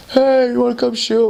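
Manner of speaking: high-pitched imitation of a player's invitation; calling over. Players invite the missionaries to shoot, calling over. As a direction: call across the court, acted out.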